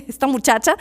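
hablar riéndose; entre risas. Real, not acted. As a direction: risa divertida que corta las palabras. Se ríe de una muchacha. (entre carcajadas)

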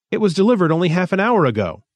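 high, quick bitter retort; a touch of bitterness, said fast. A narrator's reading. Thistle complaining about the late spacegram, bitterly. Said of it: "said Thistle bitterly".

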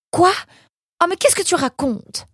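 very high-pitched incredulous outburst; shocked disbelief. A narrator's reading. Mother hearing her son must leave, incredulous. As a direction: exclaim in dismay, voice leaping up.

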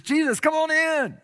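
loud, high-pitched hearty invitation; eager welcome. Acted. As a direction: call the guest in with gusto. Dreamer eagerly welcomes Jesus inside.

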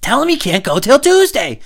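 feed lines like a pushy parent; bossy parent. Retold or imitated. Parent insisting.

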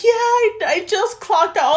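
high, put-on coy answer; fake sweetness. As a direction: imitate a friend playing it cute, high and drawn out. Mimicking friend's fake flirty reply.

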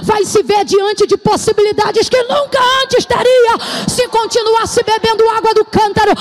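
loud, high, shouted prophecy with slow stretched calls; full-force preaching. Acted. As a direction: proclaim it like a prophet over the crowd, at full voice. (pregação profética aos gritos)

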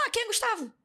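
high-pitched voice for the retold boy; imitated boy. Boy asking the girl who Gustavo is, in high-pitched imitation. Acted boy replying to her.

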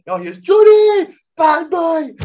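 very high, loud and slow; hammed-up gleeful shout; mock horror-movie taunt. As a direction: bellow it like a horror villain. Shining-style taunt at drunk friend.

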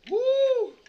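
hammed-up drunk woman's whoop; mock woo. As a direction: imitate her drunk victory cry. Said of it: "takes fries and goes".

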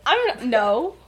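high, stammering protest; hammed-up flustered sputter; flustered denial. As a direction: trip over the denial in mock panic.

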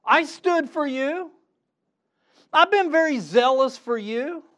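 strained high complaint; frustrated pleading Elijah. Acted. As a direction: cry out in exasperation at God. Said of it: "he's like".

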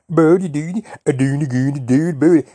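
a Swedish man greeting him in mock gibberish. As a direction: play a silly foreigner babbling nonsense. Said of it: "speak like this".

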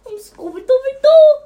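high, loud cartoon dog; Scooby-Doo voice. Friend showing how Scooby-Doo really sounds, high and loud. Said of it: "sounded a lot more like Scooby-Doo".

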